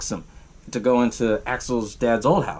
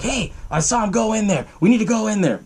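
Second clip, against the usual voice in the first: mock urgent alert; 7 semitones higher than usual. Burke urgently alerts deputy.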